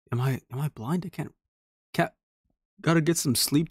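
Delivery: ordinary talk, nothing marked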